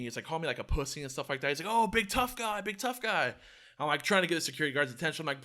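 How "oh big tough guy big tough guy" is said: high, jeering imitation; imitated sing-song taunt; mock taunting. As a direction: goad him in a higher voice. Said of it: "He's, like, taunting me".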